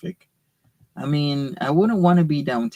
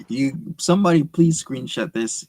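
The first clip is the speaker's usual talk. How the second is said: usual speaking voice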